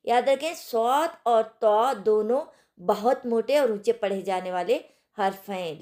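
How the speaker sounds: usual speaking voice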